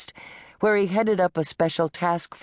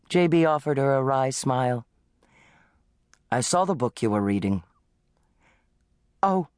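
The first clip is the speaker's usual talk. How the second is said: lightly voiced husband, a touch teasing. A narrator's reading. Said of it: "His teasing tone".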